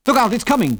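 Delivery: high, loud urgent shout; jerked-out warning. A narrator's reading. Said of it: "jerked out Biggles".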